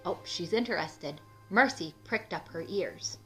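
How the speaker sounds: ordinary delivery, nothing marked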